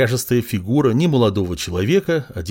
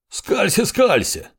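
sharp angry snap, pitched much higher; snapping; 11 semitones higher, slower than usual. A narrator's reading. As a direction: snarl it back at him. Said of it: "огрызнулся воевода".

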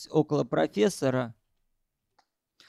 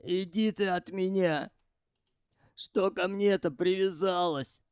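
нервно; 4 semitones higher than usual. A narrator's reading. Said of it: "и нервно повторил".

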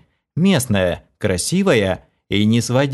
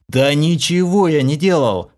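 exasperated outburst; 5 semitones higher than usual. A narrator's reading. The hero denies everything, exasperated outburst. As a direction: burst out defending yourself. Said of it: "взорвался я".

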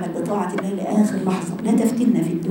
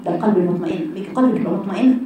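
ordinary talk

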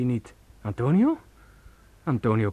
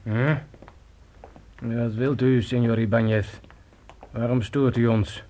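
louder insistent voice; menacing demand; 3 semitones higher, 5 dB louder than usual. Acted. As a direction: press him hard. Storyteller confronts Ibáñez loudly.

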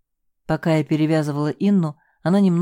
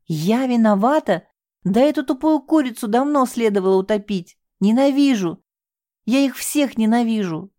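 furious outburst; 6 semitones higher than usual. Acted. Девушка в ярости кричит следователю о ненависти. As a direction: spit out the hatred.

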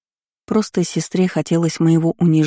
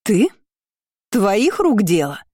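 higher, slow accusation; accusing aunt; 5 semitones higher, slower than usual. Acted. The aunt accuses the heroine, higher and slow. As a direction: accuse her coldly.

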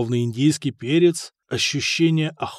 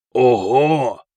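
low, loud delighted exclamation; delighted yell; 4 semitones lower, 6 dB louder than usual. A narrator's reading. Sigurd sees the gold on the table, delighted yell. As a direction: boom it out in rough-and-ready delight. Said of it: "восторженно заорал Сигурд".

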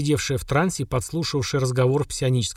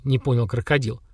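ordinary delivery

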